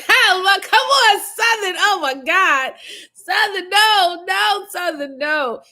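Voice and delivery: high, loud laughing no; laughing protest. Real, not acted. Laughing at the commenter Southern's confession, high and loud. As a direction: cry no at the commenter through laughter. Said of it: "reacting to commenter Southern".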